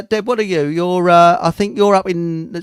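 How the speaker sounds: ordinary talk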